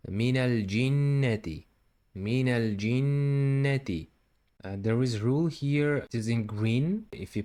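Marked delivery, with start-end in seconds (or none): 0.1-4.1 Minal jinnati with a held nn; doubled nun. Shown as a demonstration. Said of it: "there is doubling nun here".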